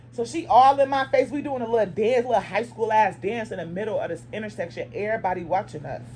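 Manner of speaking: ordinary delivery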